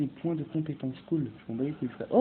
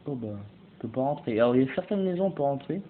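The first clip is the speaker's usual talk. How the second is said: usual speaking voice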